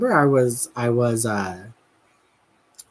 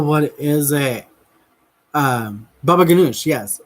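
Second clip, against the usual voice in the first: normal talking voice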